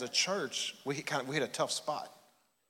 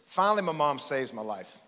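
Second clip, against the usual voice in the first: usual speaking voice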